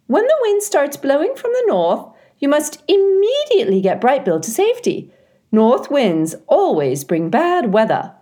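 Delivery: a touch of a fussy neighbour mother; lightly voiced mother animals. A narrator's reading. Other mothers giving advice.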